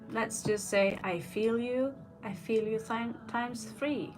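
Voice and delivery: ordinary talk, nothing marked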